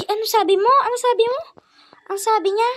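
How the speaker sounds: high loud startled question. Real, not acted. Vlogger startled by a viewer's message. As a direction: demand what they just said.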